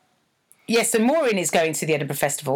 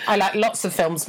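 normal talking voice

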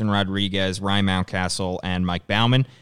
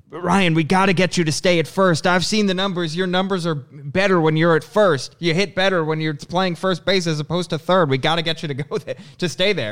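lightly voiced eager fan; 7 semitones higher than usual. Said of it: "one fan asked Ryan Mountcastle, he goes".

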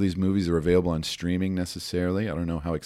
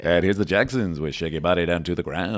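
roller rink DJ voice; 7 dB louder than usual. Acted. Continues roller rink DJ bit.

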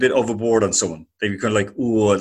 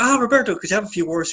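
high, lifted voice calling someone over; eager calling out; 10 semitones higher, faster than usual. Retold or imitated. Interviewer asking football manager for words, eagerly calling out. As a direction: pitch it up to catch his attention. Retelling his approach to a manager.

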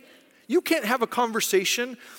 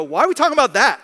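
loud, incredulous challenge; mock exasperation; 3 semitones higher, 8 dB louder, faster than usual. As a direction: ask it as if fed up with the squabbling. Imagines Jesus exasperated at us.

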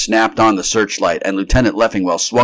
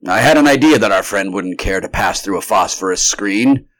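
plain reading voice

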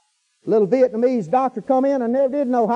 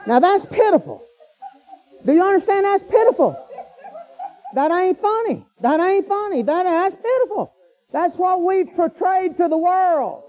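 preaching at full force; 6 semitones higher than usual. Real, not acted. Preacher scolds the congregation, high and insistent. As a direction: drive the point home to the crowd. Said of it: "preaching at full force".

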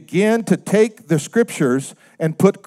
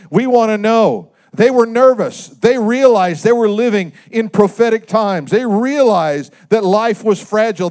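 higher, louder preaching build; rising preaching; 5 semitones higher, 4 dB louder than usual. Acted. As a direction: lift the congregation into urgency. Preacher builds on disciples' question.

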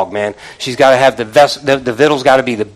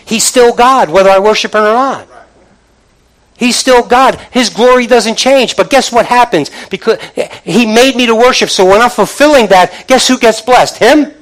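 high, loud climactic preaching; rising sermon climax; preaching at full force; 7 semitones higher, 6 dB louder than usual. Acted. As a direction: lift the congregation up.

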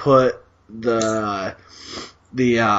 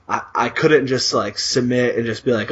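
usual speaking voice

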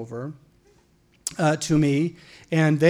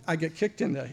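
ordinary delivery

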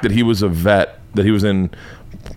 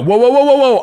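alarmed whoa; 14 semitones higher, 6 dB louder than usual. Pretend. Mock alarm at news framing.

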